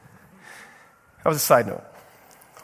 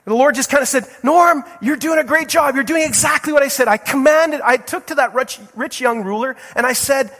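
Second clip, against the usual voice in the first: high, loud, rousing preaching; preaching at full force; 9 semitones higher, 5 dB louder than usual. Acted. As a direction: stir them up with God's charge. Preacher voicing God's affirmation.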